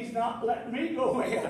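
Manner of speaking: normal talking voice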